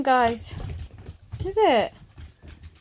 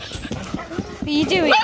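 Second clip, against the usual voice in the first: ordinary delivery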